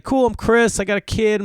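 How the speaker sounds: high, sociable introduction; breezy small-talk voice; imitated friendly neighbor. Neighbour introduces himself back.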